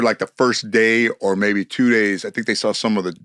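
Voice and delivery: ordinary delivery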